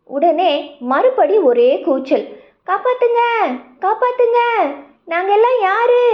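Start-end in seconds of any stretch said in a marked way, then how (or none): 2.7-6.0 much higher panicked cry for help; animals screaming. Acted. Frightened forest animals calling for rescue, high panicked cry. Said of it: "எல்லாரும் அலறினாங்க".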